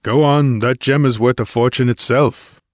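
firm push to hurry her along; impatient warrior's order; commanding urging. A narrator's reading. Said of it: "he commanded".

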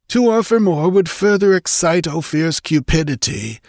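put-on high-pitched old counsellor pleading his case; careworn elderly chancellor objecting. A narrator's reading. Elderly chancellor argues with princess.

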